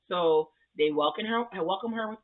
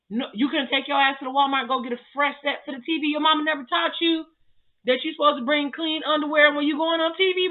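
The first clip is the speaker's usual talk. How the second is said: loud, high-pitched exasperated rant; indignant scolding; 10 semitones higher, 6 dB louder than usual. Real, not acted. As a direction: tell him off like an outraged auntie, high and loud. Scolding groom over stained underwear.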